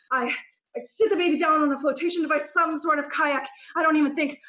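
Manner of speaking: high, loud, fast rush of a chase; buzzing from the chase, words tumbling out; breathless excitement. Acted. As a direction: tell it like you're still running. Frantic escape with baby.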